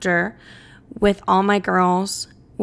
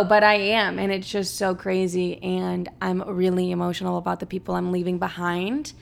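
normal talking voice

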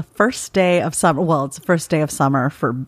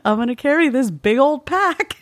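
mocking fit hiker; 9 semitones higher than usual. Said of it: "They're just".

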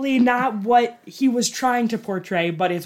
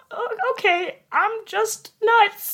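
sheepish; 14 semitones higher, slower than usual. Retold or imitated. Self-mocking reply, sheepish.

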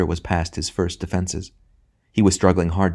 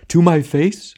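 theatrical wounded outrage, pitched up in bursts; storming; 5 semitones higher, 3 dB louder than usual. A narrator's reading. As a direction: ham tragedian's indignant tirade. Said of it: "it stormed".

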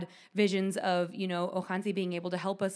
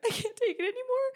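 high, breaking voice, half laughing, half crying; retold bawling. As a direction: replay the breakdown with a tearful laugh. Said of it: "that's when I started bawling".

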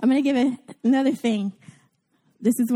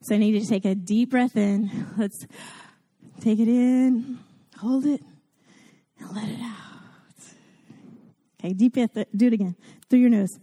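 calm breathing cues with long pauses; leading the congregation in a breathing exercise, calm; guided breathing. Shown as a demonstration. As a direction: lead the breath gently. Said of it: "do a little exercise together".

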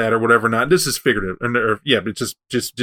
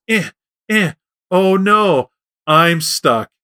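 higher-pitched mock straining and dismay; stuck worm; 5 semitones higher, much slower than usual. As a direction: lightly play a helpless little worm. Worm gets stuck in web.

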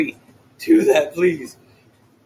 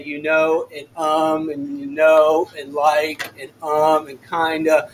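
mock monotone filler words; 8 semitones lower, slower than usual. Shown as a demonstration. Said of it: "Please speak monotone and say".

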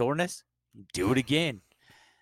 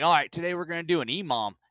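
higher, mock-upbeat coach; coach announcing workout; 7 semitones higher, 4 dB louder, faster than usual. Coach tells class today's workout, mock keen announcement.

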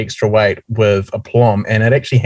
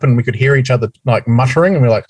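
usual speaking voice

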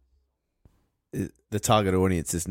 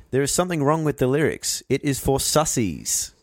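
higher-pitched, deliberate comment reading; mock-solemn reading; 6 semitones higher than usual. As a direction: read it out as a grand pronouncement, slowing at the end. Reads out a YouTube comment, mock earnest.

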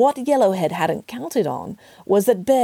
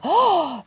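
sharp acted gasp of horror; horrified gasp; 10 semitones higher than usual. A narrator's reading. Children hearing of a terrible crime, horrified gasp. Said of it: "The children gasped in horror".

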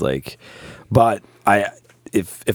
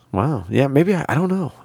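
ordinary talk

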